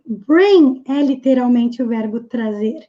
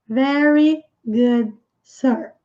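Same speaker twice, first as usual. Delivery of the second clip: slow model reading; much slower than usual. Shown as a demonstration. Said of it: "teacher models the line slowly".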